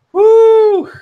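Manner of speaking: high, loud "woo" of release; relieved whoop. Real, not acted. Releases tension after the scare.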